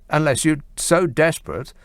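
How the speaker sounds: normal talking voice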